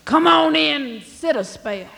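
hollering a welcome from the shop; yelled out. Acted. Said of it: "Wicked John yelled out at him".

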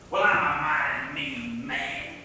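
drawn out slowly, a lightly voiced monster's boast; slow menacing boast. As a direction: savour each word like a mean brute.

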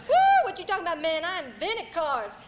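high whoop into a loud brag; storyteller's loud, high-pitched boasting devil; boastful devil. Acted. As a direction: crow it like a cocky devil. Devil brags to Boo about cards.

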